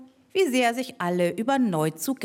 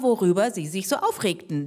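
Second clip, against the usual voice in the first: ordinary delivery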